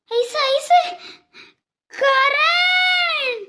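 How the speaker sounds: long drawn-out high wail of the name; drawn-out shocked wail; anguished cry of a name. Acted. Mother hears of accident.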